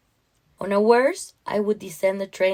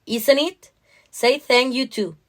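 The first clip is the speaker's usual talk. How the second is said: raised, insistent parental prompt; stern prompting; 5 semitones higher, 4 dB louder than usual. Acted. Mother making Anna thank the guard, pointed and stern. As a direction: press the child to say thanks, voice up.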